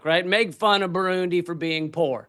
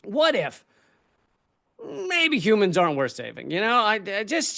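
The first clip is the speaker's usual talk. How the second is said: ordinary talk, nothing marked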